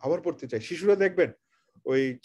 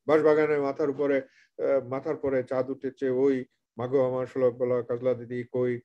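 dull teacher recitation; 6 semitones lower than usual. Shown as a demonstration. Mocking how most teachers read a poem aloud, dull lower drone. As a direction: drone the poem like a bored schoolteacher. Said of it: "যেভাবে বেশিরভাগ শিক্ষক পড়ান".

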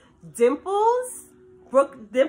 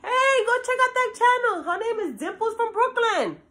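high-pitched pretend fan plug; hyped fan call-out; 9 semitones higher than usual. Imagined fan telling friends to check out her channel, hyped. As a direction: call out to friends with big hype.

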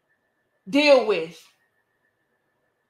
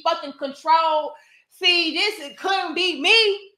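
mock outrage; 2 semitones higher than usual. Woman imitating critics of her statement, mock-outraged. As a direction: mimic an appalled detractor, dragging out the opening.

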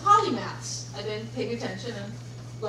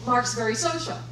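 ordinary delivery

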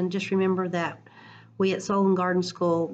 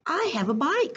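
calling out; 10 semitones higher than usual. Acted. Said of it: "called Papa".

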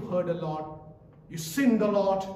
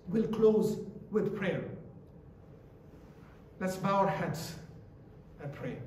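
normal talking voice

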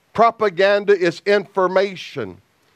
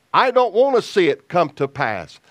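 normal talking voice

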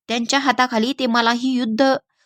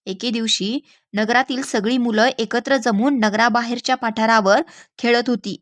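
usual speaking voice

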